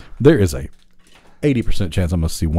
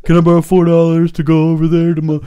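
higher, pleading hustler's pitch; mock gas station panhandler; 11 semitones higher, 4 dB louder than usual. Imitating the panhandler begging at the gas station, higher pleading voice. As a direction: wheedle for four dollars like a con man.

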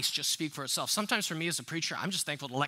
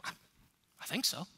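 softer, hesitant, half-whispered; unsure mumble; 7 dB quieter than usual. Pretend. A believer unsure if they're a disciple, hesitant soft mumble. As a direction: trail off doubtfully. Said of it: "You're like".

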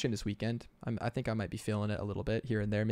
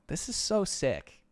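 excited; 9 semitones higher, slower than usual. Real, not acted. Hyped reaction.

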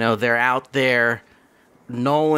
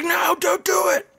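brother pleading. Retold or imitated. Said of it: "And his brother's like".

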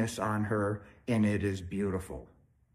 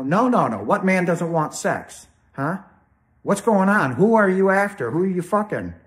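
louder and higher, building accusation; jealous outburst; 8 semitones higher, 7 dB louder than usual. Retold or imitated. Wife yelling at partner who refuses sex, loud accusing. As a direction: shout her suspicion at him.

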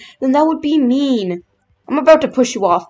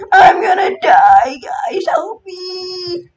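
screaming for help; 7 semitones higher, 3 dB louder, much slower than usual. Acted. Falling off, calling for help.

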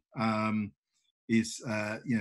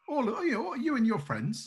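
much higher, coaxing voice; Oksana coaxing; 10 semitones higher than usual. Acted. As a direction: draw them in sweetly. Oksana inviting friends along.

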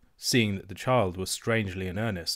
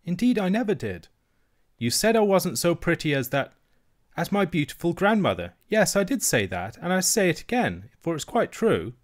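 put-on high, firm little girl; young princess standing her ground; child princess; 8 semitones higher, 3 dB louder than usual. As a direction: insist gently but firmly. Princess defends her words.

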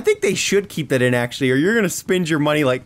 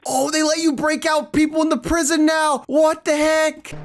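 excited shout; 9 semitones higher than usual. Real, not acted. Gamer shouting at a new feature. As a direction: burst out with surprise. Discovers prison breakout feature.

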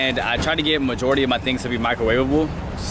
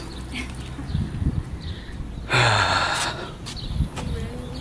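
long sigh; 6 dB quieter than usual. Real, not acted. Sigh before setting off.

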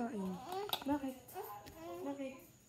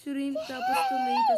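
excited shout; 9 dB louder than usual. Real, not acted. Excited food listing.

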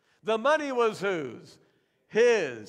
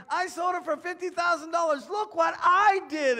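boastful shout; 8 semitones higher, 6 dB louder than usual. Acted. Ananias shouting his boast to Peter, loud and high. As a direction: crow over the gift, shouting it out.

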